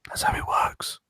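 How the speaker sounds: hushed joking whisper; playful whisper. Pretend. Still whispering the gag to the producer. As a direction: keep the joke going in a whisper. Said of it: "And if I whisper".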